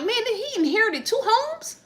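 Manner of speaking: high, incredulous question; imitating her own shocked reaction; mock disbelief. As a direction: sound stunned at the news. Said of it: "I'm like".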